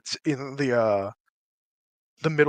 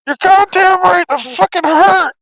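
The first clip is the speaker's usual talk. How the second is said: put-on screaming in a New York accent, much higher and louder; New York accent shout; 12 semitones higher, 12 dB louder than usual. The hurt voice complains to the party in a New York accent shout. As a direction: yell it like a furious New Yorker in pain. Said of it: "sorry for the New York accent".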